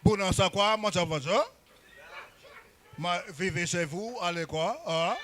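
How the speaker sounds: sweet-talking the French people politely; soft French-speaking Congolese man. Retold or imitated. Congolese man being soft with French people, low and gentle. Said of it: "they'll be like".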